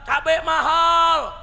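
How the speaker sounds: imitating a crowd's high, drawn-out yell; protesting shout. Mothers protesting to the government about chilli prices, shouting. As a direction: cry it out loud, stretching the words. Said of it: "Bukan ibu-ibu teriak".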